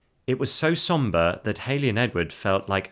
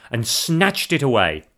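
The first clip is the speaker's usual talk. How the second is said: plain reading voice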